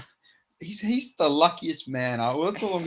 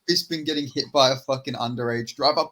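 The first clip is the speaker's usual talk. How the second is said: ordinary talk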